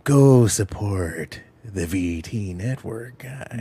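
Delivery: slow, breathy mock Reagan whisper; whispery Reagan. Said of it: "whispery Reagan".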